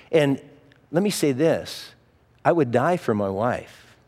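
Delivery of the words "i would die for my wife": normal talking voice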